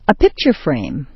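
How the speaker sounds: sharp stress on "picture"; "picture" peaks, "frame" drops away; first noun stressed. Shown as a demonstration. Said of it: "first one is sharply stressed".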